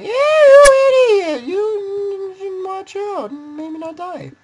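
very high, slow, drawn-out screech; hammed-up angry viewer screeching, voice cracking; mock screen yelling. Imitating viewers yelling at their computer screens, hammed-up screech. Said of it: "start yelling at their computer screens".

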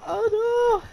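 high, loud alarmed cry; panicked yell. Real, not acted. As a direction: shout it in panic. Panicked shout while chased.

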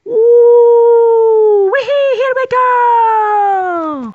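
loud drawn-out whoop; excited cheer. Acted. Pepper cheering dad's dive, loud stretched whoop. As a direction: cheer him on as he leaps. Dad jumps off diving board.

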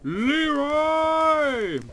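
long high drawn-out yell; Leroy Jenkins battle cry. Retold or imitated. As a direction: charge in yelling the name. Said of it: "Leroy Jenkins".

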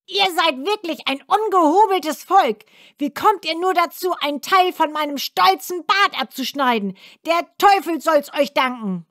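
hohe, keifende Zwergenstimme, schnell und gereizt; zeternder Zwerg. A narrator's reading. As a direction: schimpf wie ein ungeduldiger alter Zwerg. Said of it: "und rief den Mädchen zu". (hohe, zeternde Zwergenstimme)

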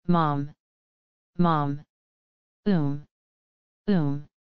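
lightly voiced child whimpering for mom; a small lost child sobbing, read gently; child crying. A narrator's reading. Lost child in the ruined hall calls for mother, a touch of crying. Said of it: "the terrible crying voice".

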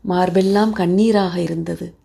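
ordinary delivery

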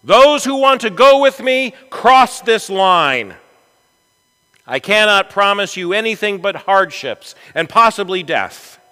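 high, loud challenge to weary men; rallying commander's address; Pizarro. Acted. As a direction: issue a bold ultimatum, loud and high. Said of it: "He looked them in the eye and he said this".

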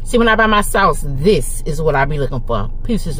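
slightly muffled by food; chewing between words; talking while chewing. Real, not acted. Seen 0: Lips closed and working between words, meat bowl held up.

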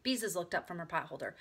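ordinary delivery, nothing marked

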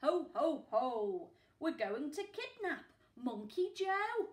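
gloating ho ho of a crook; chuckling villain. Acted. Narrator voicing the villains plotting, with a gloating chuckle. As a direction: chuckle it out like a scheming thief. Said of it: "she heard them chuckle".

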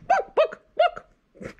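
very high cluck; polite hen cluck. Acted. Hen asking the librarian for a book, clucking. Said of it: "clucked the hen".

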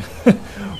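high, amused laugh; hearty chuckle. A narrator's reading. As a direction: chuckle to yourself. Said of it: "chuckled Hurricane".

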